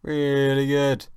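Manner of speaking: loud, high, drawn-out cheer; over-the-top praise. Real, not acted. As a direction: stretch it out big and loud. Hyping himself up.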